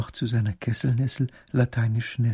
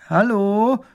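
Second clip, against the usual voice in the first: calling out; 9 semitones higher, 7 dB louder than usual. Acted. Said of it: "ruft Emil".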